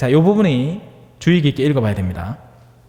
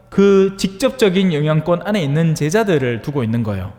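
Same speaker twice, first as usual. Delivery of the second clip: ordinary delivery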